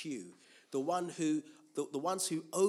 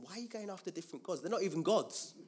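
exasperated God; 6 semitones higher, faster than usual. Acted. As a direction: plead quickly, then hit the last phrase. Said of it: "he always says to them".

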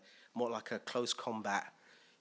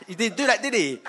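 loud, raised pitch through laughter; amused; 7 semitones higher, 11 dB louder than usual. Real, not acted. Preacher joking with congregation about Jesus and Satan, amused. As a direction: laugh it out to the room.

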